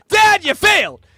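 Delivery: high, loud accusing scream; furious yell. Pretend. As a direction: scream it at your father. Imagined child yelling at dad.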